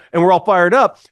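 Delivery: ordinary delivery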